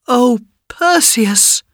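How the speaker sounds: high, drawn-out cry of relief; overjoyed cry. A narrator's reading. Mother sees her son alive, crying out high. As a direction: cry out his name like a long-lost mother. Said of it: "cried his mother".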